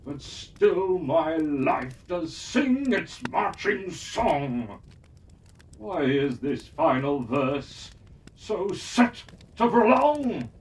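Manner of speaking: put-on a touch of an old hero pleading for a new challenge; lightly voiced weary champion. Weary champion begs for one final challenge, earnest.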